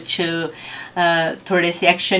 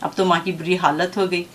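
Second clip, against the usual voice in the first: usual speaking voice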